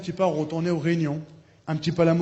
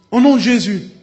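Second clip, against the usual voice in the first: loud rebuke of the devil; preacher rebuking the devil, loud; commanding rebuke; 4 semitones higher, 10 dB louder than usual. Retold or imitated. Casting out the devil, retold.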